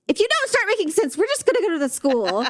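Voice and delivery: high-pitched mock threat; lightly voiced mother losing patience; impatient mom. Mom warns her upset kid, mock impatience.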